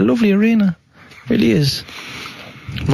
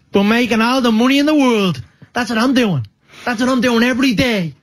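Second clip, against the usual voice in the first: much higher Dublin Crumlin swagger; Conor McGregor; 7 semitones higher than usual. Shown as a demonstration. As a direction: crow it to a press conference in high Dublin tones. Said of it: "when he's in press conferences".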